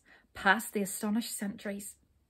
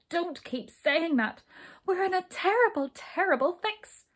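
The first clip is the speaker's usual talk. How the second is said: upset; 8 semitones higher, 4 dB louder than usual. Acted. Brownie upset.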